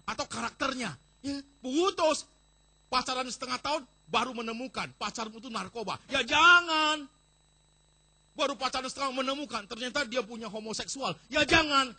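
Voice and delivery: loud, high sermon warnings; preaching at full force. Acted. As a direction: boom each no at them.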